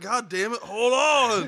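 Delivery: loud, high cursing plea; fed up, yell for them to stop; bleeding kid shouting at his friends to quit; exasperated yell. Retold or imitated. Bleeding, demanding pause.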